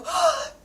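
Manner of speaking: big high excited gasp; thrilled gasp at a dog; delighted gasp. Shown as a demonstration. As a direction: gasp like you just saw a puppy. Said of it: "is to go".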